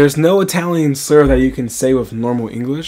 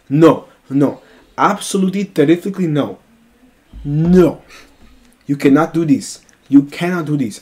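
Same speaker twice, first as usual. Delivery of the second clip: louder, higher, punched-out refusals; emphatic protest; 3 semitones higher, 5 dB louder than usual. Retold or imitated. Mattia stopping him reaching the girl, emphatic protest. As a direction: forbid it, hitting every "no". Friend acted out forbidding the plan.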